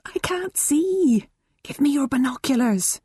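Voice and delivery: high whispered order from Mum; hushed whisper. Acted. Mum whispering to Helen at the window, high hushed order. Mum peering through net curtains.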